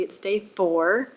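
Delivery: day four, with a slow rising stress on four. Shown as a demonstration. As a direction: lean on the word four, drawn out. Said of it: "it is not day three".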